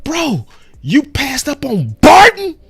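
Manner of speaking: high, slow disbelief; incredulous. Retold or imitated. A fan ribbing a friend over a skipped player, high disbelief. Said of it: "and you like".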